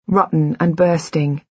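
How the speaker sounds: plain reading voice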